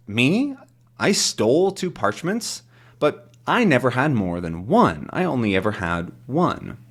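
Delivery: mildly indignant storybook hero; Tintin, incredulous. Acted. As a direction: act astonished at the accusation. Voices Tintin protesting the accusation.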